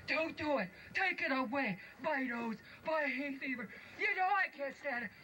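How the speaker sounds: high, pleading dwarf fighting a sneeze; sneezy dwarf. Acted. Sneezy begging them to take the flowers, high and pleading.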